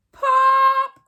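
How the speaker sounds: high loud popping noise; a sharp burst of a pop; pop sound. A narrator's reading. Test begins with a pop.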